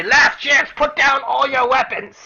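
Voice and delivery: high, loud goblin ultimatum; goblin shout. Acted. Goblins give the party a final warning, shouting. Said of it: "they say".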